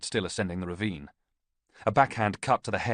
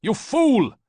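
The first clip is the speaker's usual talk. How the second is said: furious yell, much higher and louder; 10 dB louder than usual. A narrator's reading. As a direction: scream the insult at the priest. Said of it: "Ballista yelled".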